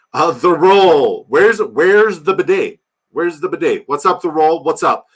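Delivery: loud high drawn-out greeting; hype greeting. Real, not acted. Streamer greeting a chat regular with a loud high hype shout. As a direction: belt out the viewer's name like a hype man. Greeting a viewer with running joke.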